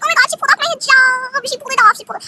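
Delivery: high, loud dramatic wail; imitating a scandalized girl's outcry; mock outcry. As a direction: cry out in hammed-up outrage, then drop off. Acted outcry over pulled hijab.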